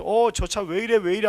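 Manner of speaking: imitated startled shout at another car; alarmed driver. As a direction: act out a driver's sudden alarm. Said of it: "하면서 핸들 왼쪽으로 확 꺾어요".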